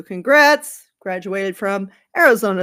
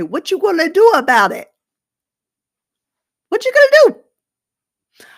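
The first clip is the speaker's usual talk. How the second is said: loud, high-pitched taunting yell; brash imagined comeback, shouted; defiant challenge; 9 semitones higher, 8 dB louder than usual. Acted. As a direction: holler it as a dare, climbing higher. Acts player defiantly challenging the team.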